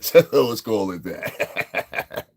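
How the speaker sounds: slowed words through laughter; a slow line squeezed out through a laugh. Real, not acted. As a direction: say it while still cracking up. Still laughing.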